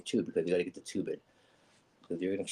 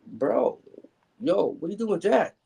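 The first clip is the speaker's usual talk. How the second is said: high, loud, incredulous objection; alarmed protest; 13 semitones higher, 6 dB louder than usual. Retold or imitated. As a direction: protest in alarm, pitch jumping way up. Alarmed protest to the surgeon, retold.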